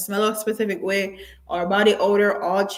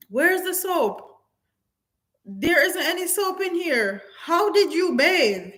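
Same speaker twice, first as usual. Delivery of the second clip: put-on incredulous girlfriend; 8 semitones higher than usual. Retelling a woman questioning her man about soap, high and disbelieving. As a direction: voice the shocked girlfriend demanding answers. Woman confronting boyfriend on video.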